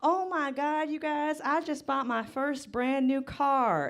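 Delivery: high, slow gushing brag; gushing classmate. Retold or imitated. Comic mocking classmate's new-car post, high slow gushing. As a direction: gush like a smug classmate. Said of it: "she updated saying".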